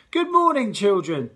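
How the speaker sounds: high, loud sing-song greeting; cheery teacher greeting. Acted. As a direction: greet the whole hall brightly. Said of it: "said Mister Barrington".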